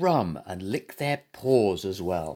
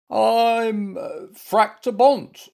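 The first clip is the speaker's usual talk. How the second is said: high, loud, drawn-out bear voice; muddled little bear; 10 semitones higher, 6 dB louder, much slower than usual. A narrator's reading. Bear with head back to front explains, high muddled voice. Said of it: "explained Goompa, whose head had turned".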